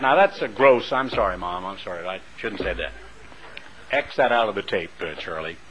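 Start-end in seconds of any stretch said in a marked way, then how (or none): 0.9-2.9 dropped low and soft, apologizing; sheepish aside. Real, not acted. Preacher apologizing to his mother for a crude word, softly sheepish. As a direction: murmur a quick sorry to Mom.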